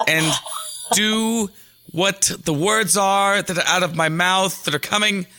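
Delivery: each phrase set apart with strained precision; John Malkovich. Acted. Upset star asking everybody to listen, tensely insistent. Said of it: "tense John Malkovich".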